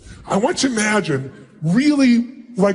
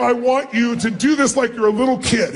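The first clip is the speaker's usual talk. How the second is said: ordinary talk, nothing marked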